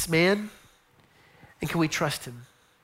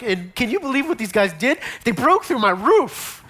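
imitating Peter, higher and louder with outrage; indignant Peter; 4 semitones higher, 5 dB louder than usual. Peter telling guests how his roof was broken, mock outraged. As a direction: retell it as Peter still amazed at his wrecked roof. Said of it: "he'd be like".

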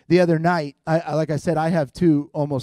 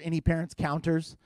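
ordinary talk

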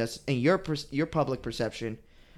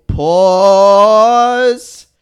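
loud pause; 6 semitones higher, 17 dB louder than usual. Real, not acted. Reacting to noise.

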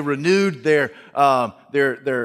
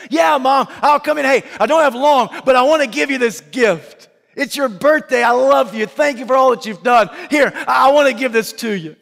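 loud and higher, eager but busy; hurried cheerful son; 10 semitones higher, 6 dB louder than usual. Acted. Son handing mom a birthday gift in a hurry, loud and eager. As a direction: talk fast and upbeat, no time to stay.

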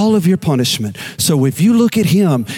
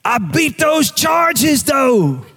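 high, slow, drawn-out proclamation; triumphant declaration; 11 semitones higher, much slower than usual. Retold or imitated. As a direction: savor every word like a freed man. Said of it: "finally, he said these words".

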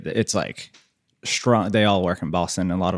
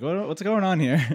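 mock baffled, pitched up; puzzled what's going on here, higher; 7 semitones higher than usual. Confused by dad's accent rubbing off, baffled. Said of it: "I'm like".

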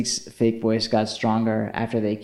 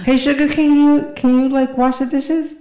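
high, loud put-on nagging voice; annoying roommate; 6 dB louder than usual. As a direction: ask in an exaggerated, pitched-up voice. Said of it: "Could you imagine being Sugarcane's roommate".

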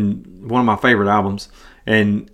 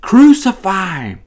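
raised, high mock mob shout; mob roar; 6 semitones higher, 4 dB louder than usual. A narrator's reading. Mob answering Pilate even louder, high raised shout. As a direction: shout it like a riled mob, pitched up. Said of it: "the mob roared even louder".

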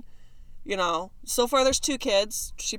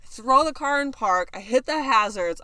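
usual speaking voice

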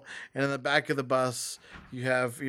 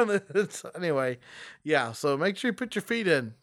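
ordinary talk, nothing marked

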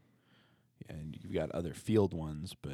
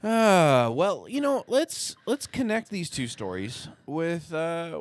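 booming preacher voice; 11 semitones higher, 10 dB louder, slower than usual. Pretend. Mock solemn sermon start.

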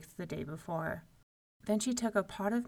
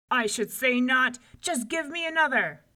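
loud, higher bluster; indignant shout; 5 semitones higher, 8 dB louder than usual. A narrator's reading. Giant demanding another loaf, shouting. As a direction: insist loudly like a proud bully. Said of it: "shouted Cuchulainn".

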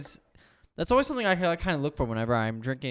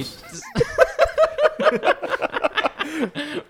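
helpless high laugh breaking the sentence; loud high giggle fit; high burst of laughter; 6 dB louder than usual. Real, not acted. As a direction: break up laughing mid-thought.

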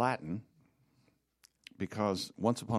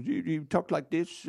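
higher, put-on coach voice; odd-talking coach; 6 semitones higher than usual. Shown as a demonstration. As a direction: demonstrate the coach's peculiar way of talking. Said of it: "he had a real odd way of speaking".

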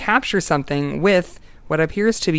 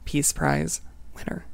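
whispered; 8 dB quieter than usual. Shown as a demonstration. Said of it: "I said that so quietly".

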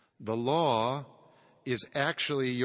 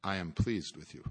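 lower, softer, tender reassurance; lower, softer tender voice of Christ; 7 semitones lower, 6 dB quieter than usual. Acted. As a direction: speak it gently as Christ comforting. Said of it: "He sounds like this".

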